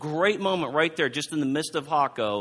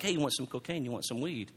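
much softer, furtive street pitch; hushed dealer offer; 3 semitones lower, 10 dB quieter, faster than usual. Retold or imitated. Street dealer sidling up offering cocaine, hushed and furtive. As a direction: keep it under your breath like a dealer.

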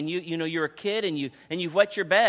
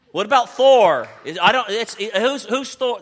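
louder, bright suggestion; loud playful pitch; 4 semitones higher, 9 dB louder than usual. Real, not acted. Pastor loudly suggesting Thor to the women. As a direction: throw it out to the crowd.

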